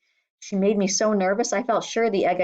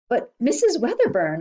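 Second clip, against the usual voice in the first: protesting; 6 semitones higher, 4 dB louder than usual. A narrator's reading. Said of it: "I protested".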